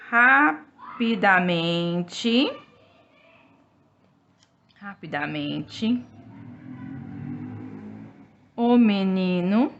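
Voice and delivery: word by word, long pauses between; syllables drawn out for children to copy; slow dictation. Shown as a demonstration. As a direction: dictate slowly while writing on the board. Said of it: "teacher dictating while writing on board".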